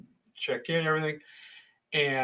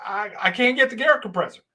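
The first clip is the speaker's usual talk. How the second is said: higher-pitched, halting protest; confused stammer; 6 semitones higher than usual. Retold or imitated. Worker explaining the locked trailer to foreman, confused. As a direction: imitate your baffled self explaining. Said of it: "I'm just sort of confused".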